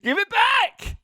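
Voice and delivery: high-pitched comic yell; hammed-up yelled demand; mock yell. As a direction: yell it in a high, playful squeal. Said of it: "you have to yell".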